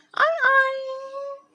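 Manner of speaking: high squeaky oink; pig oink. Pretend. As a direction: oink like a cartoon piglet. Teacher imitating pig grunt.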